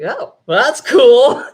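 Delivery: retold delighted reaction. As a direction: take the news with playful delight.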